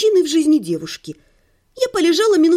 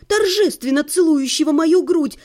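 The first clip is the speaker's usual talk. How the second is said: ordinary delivery, nothing marked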